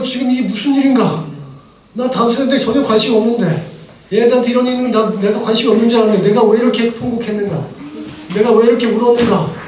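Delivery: loud astonished asking why he wept; bewildered exclaiming. Retold or imitated. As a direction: cry out in disbelief at yourself. Retells bewildered self-questioning after weeping.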